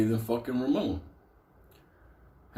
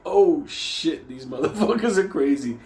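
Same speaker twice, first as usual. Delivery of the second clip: mock shock; 6 semitones higher, 5 dB louder than usual. Shocked aside.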